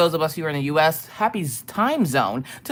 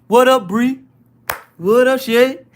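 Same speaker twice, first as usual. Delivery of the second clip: loud, high hype greeting; loud party shout-out; hyped shout-out; 5 semitones higher, 7 dB louder than usual. Acted. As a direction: call out each name like a hype man.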